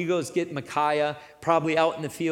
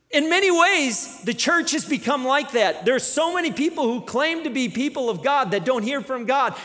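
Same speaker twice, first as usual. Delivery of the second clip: raised, higher preaching voice; preaching full force; 7 semitones higher, 4 dB louder than usual. Acted. Preacher challenging the church, raised and pressing. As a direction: drive the point home to the congregation.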